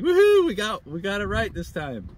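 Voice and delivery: high, loud celebratory whoop; fired up. Retold or imitated. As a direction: cheer like you just nailed it. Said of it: "I'm all getting fired up".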